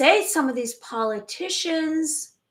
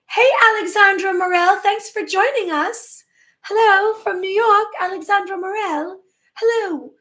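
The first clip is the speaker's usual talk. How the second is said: high and loud, bright welcome to a viewer; greeting a livestream viewer who just joined, excitedly; 7 semitones higher, 6 dB louder than usual. Real, not acted. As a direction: call out the viewer's name with delight.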